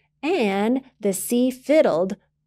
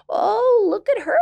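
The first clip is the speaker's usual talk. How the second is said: high, louder alarmed granny; granny seeing the girl swept away; gasping granny; 13 semitones higher, 4 dB louder than usual. Acted. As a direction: gasp it out in fright. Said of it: "Granny gasped".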